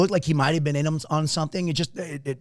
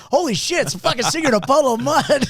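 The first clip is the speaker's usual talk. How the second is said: high, louder burst of disbelief; retold shock, pitched way up; astonished realization; 10 semitones higher, 5 dB louder than usual. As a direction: blurt it out, amazed and loud. Retelling their astonished realization of who he was.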